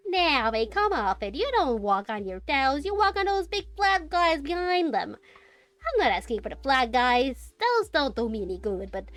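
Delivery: lightly voiced Australian merchant; a hint of an Australian trader selling his wares; Australian accent. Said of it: "the Australian accent".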